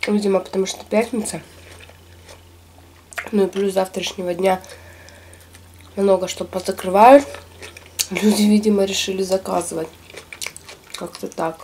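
talking around a bite of sushi; speaking between chews; mouth full, chewing. Real, not acted. Seen 0: Bites sushi at 4.7; mouth visibly full while talking after.

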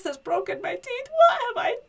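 high, loud hammed-up wail; despairing wail. Giant with broken teeth regretting his visit, wailing. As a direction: cry out in mock despair.

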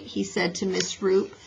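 ordinary delivery, nothing marked

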